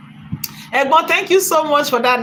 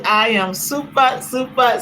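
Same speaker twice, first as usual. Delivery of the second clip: usual speaking voice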